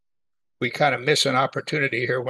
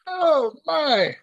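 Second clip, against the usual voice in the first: much higher, a pained sigh of disbelief; dismayed oh my; 12 semitones higher than usual. Real, not acted. Preacher reacting to the husband's boast, high pained disbelief. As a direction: react as if the line made you wince. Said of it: "reacting to the husband's line".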